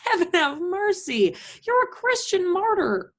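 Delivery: high, drawn-out commiseration; gushing sympathy. Acted. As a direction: pour on the pity in a high voice. Acted gushing sympathy on phone.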